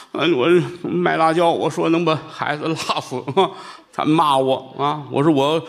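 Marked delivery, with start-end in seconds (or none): none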